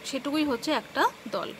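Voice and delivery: ordinary talk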